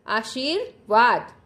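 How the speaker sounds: "आशीर्वाद" slowly, split before the last syllable; slow model pronunciation. Shown as a demonstration. Teacher has children repeat "आशीर्वाद", slowly, broken in two. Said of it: "मेरे साथ साथ बोलिएगा".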